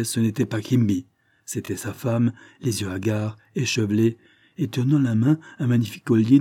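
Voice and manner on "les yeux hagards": ordinary delivery, nothing marked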